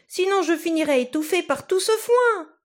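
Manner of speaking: high, loud cry of alarm; a tiny boy shrieking stop; exclaiming. Acted. Tom trapped on the cow's tongue, high loud cry. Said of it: "s'exclama-t-il".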